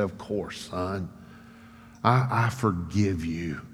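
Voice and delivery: very soft, low, slowing reassurance; hushed and gentle, easing out the words; tender forgiveness. Retold or imitated. Mother hugging her son and forgiving him, tender and soft.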